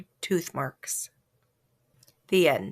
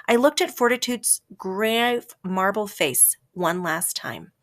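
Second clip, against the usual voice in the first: plain reading voice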